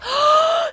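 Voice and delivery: high, horrified acted gasp; freaked-out gasp. Whitney discovering the killing, horrified gasp. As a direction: gasp sharply in mock horror. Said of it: "She is freaking out".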